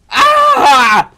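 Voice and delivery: loud, high yell of alarm; startled scream. Real, not acted. Streamer startled in the game, sudden loud scream.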